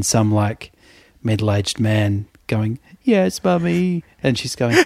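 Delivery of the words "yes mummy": higher, slow yes mummy; mock childish reply. Imagined middle-aged man answering his mother, childish. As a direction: play a grown man as a small boy.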